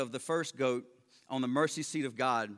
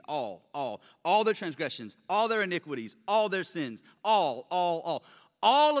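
each "all" struck, building louder; repeated stressed all; 2 semitones higher, 5 dB louder than usual. Shown as a demonstration. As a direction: hammer "all" again and again. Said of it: "I tried to stress the word all here".

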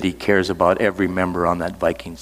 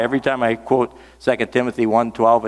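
ordinary talk, nothing marked

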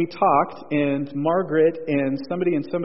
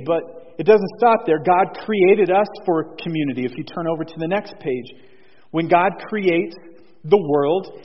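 ordinary delivery

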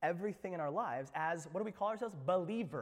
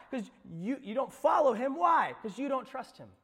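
ordinary talk, nothing marked

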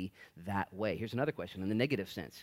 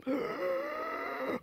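mock gasp. Mimics crowd gasping.

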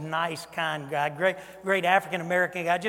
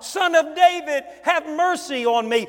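louder, higher pleading call; blind beggar's cry; 9 semitones higher, 7 dB louder than usual. Retold or imitated. Blind Bart crying louder to Jesus for mercy. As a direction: cry out even harder for mercy. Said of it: "No way was blind Bart".

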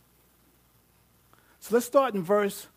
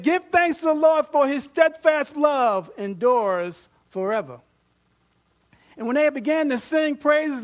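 much higher and louder, proclaiming the victory; fervent scripture preaching; 5 semitones higher, 8 dB louder than usual. Acted. Preacher proclaiming the battle story to the congregation, fervent and loud. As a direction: preach it with full force.

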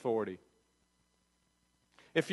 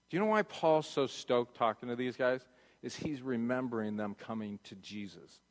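softer, intimate sermon voice; soft reflective preaching; 5 semitones lower, 5 dB quieter than usual. Acted. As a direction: draw the room in close before communion. Quiet reflective turn toward communion.

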